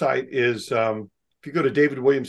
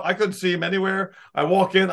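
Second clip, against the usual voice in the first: ordinary talk, nothing marked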